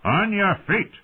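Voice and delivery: put-on master-at-arms repeating his command to the fallen boy; stern command. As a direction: bark the order again. Thorn repeats command harshly.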